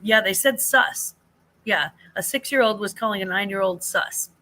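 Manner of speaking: ordinary talk, nothing marked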